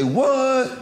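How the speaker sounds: high-pitched, mock-astonished "What?"; incredulous pastor. Pastor's disbelief on hearing eagle.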